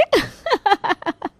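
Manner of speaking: high, falling giggle; light high-pitched cackle of amusement; teasing laugh. Acted. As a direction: laugh in character at your own tease. Character laughs after teasing.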